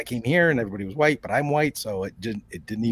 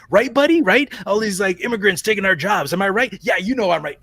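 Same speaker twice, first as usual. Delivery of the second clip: loud, high, pushy bro voice; hammed-up chummy bigot, louder and higher; mock bro ranting; 5 semitones higher, 5 dB louder than usual. Racist man on bus, voiced.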